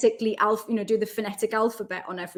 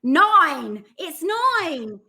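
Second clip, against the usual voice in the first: loud, higher, exaggerated Essex "noin"; exasperated mock-Essex accent, louder and higher; put-on Essex accent; 7 semitones higher, 8 dB louder, much slower than usual. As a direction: spell it out in mock Essex, pitched up. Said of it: "then I'd have to go".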